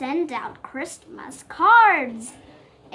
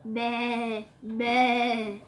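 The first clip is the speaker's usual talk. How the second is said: sheep bleat; 3 semitones lower, 6 dB quieter than usual. Acted. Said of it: "Did you hear that voice?".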